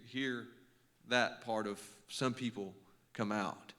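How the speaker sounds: ordinary talk, nothing marked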